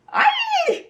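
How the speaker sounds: piercing high shriek. A narrator's reading. Flap falling through the dark, a piercing shriek. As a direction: let out a sudden terrified shriek. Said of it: "shrieked Flap".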